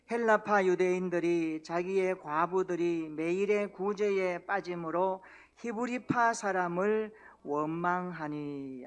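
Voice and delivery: slow, loud unison Bible reading; congregational scripture reading. Acted. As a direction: read the verse together slowly and firmly. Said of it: "한번 읽어볼까요? 자, 시작". (회중이 함께 천천히 큰 소리로 성경 봉독)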